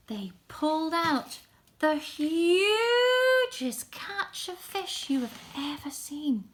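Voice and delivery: high, gasping, wide-eyed narration; amazed children's storytelling. A narrator's reading. Storyteller revealing the miraculous catch to children, amazed. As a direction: build wonder, stretching the biggest words.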